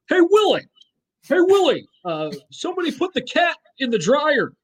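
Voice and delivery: squeaky wisecracking alien puppet; Alf impression. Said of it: "I can do a decent Alf impression".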